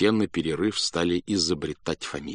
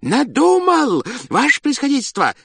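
joyful shout; 7 dB louder than usual. A narrator's reading. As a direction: burst in shouting with joy. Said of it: "закричал он радостно".

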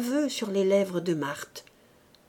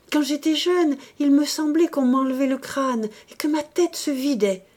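higher, louder fevered recollection; agitated confession; 6 semitones higher, 5 dB louder than usual. A narrator's reading. Woman recalls her fear of madness, agitated. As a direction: pour out the old dread.

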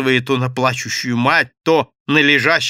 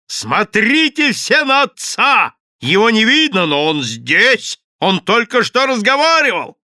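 wailing yell; 10 semitones higher, 7 dB louder than usual. A narrator's reading. Madame denouncing the invisible father to onlookers, wailing yell. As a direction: shout to gather a crowd, stretching the words. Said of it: "завопила она".

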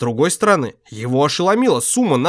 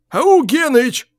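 calls out louder, higher; calling out; 13 semitones higher, 4 dB louder, much slower than usual. A narrator's reading. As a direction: shout the name across. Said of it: "повторил он громче".

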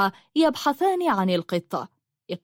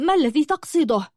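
high, hesitant, frightened question; fearful; 7 semitones higher than usual. A narrator's reading. Julanar questions the man exposing her, fearful. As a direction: falter on the first word, pitched high with fear. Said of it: "وقالت والخوف في عينيها".

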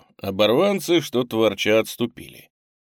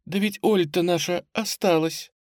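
higher, alarmed realisation; agitated; 8 semitones higher than usual. A narrator's reading. Headmistress realising Olya was left behind, agitated. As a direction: exclaim it in agitation. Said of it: "позвала взволнованным тоном".

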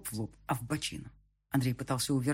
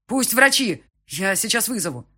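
much higher, louder frantic shouting; panicked shout; 8 semitones higher, 8 dB louder than usual. A narrator's reading. (паническим криком)

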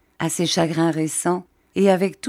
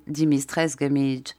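ordinary delivery, nothing marked

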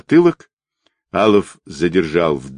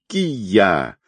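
restrained kiai, falling in pitch; a held-back martial arts yell; piercing battle cry. A narrator's reading. Bearded man leaps to strike, restrained battle cry. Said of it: "с пронзительным криком".

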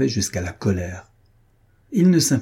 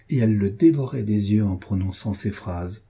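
ordinary delivery

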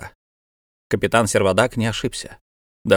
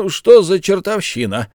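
raised-voice burst of puzzled surprise; startled exclamation; 8 semitones higher, 5 dB louder than usual. A narrator's reading. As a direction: exclaim in bafflement, higher and louder. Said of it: "воскликнул Бензуф".

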